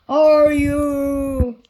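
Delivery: loud, slow giant voice; booming giant. A narrator's reading. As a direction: boom it out like a giant. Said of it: "he bellowed".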